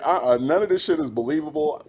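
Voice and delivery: ordinary talk, nothing marked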